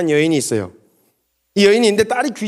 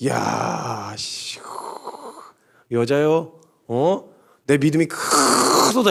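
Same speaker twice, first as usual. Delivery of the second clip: stunned praise; 4 semitones lower, 3 dB quieter, much slower than usual. Acted. Jesus suddenly praises her.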